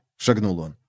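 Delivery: usual speaking voice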